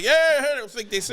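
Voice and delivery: high-pitched mock audience reply; hammed-up high interjection; mimicked chatty heckler. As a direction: imitate a heckler answering back eagerly. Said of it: "They like".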